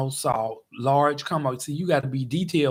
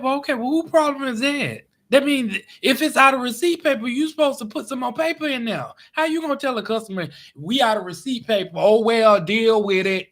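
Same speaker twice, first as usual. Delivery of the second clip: raised, louder complaint at the cashier; heated retort; 6 semitones higher, 3 dB louder than usual. Retold or imitated. Customer arguing with the cashier about receipt paper, heated and raised. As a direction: push back hard, pitch up.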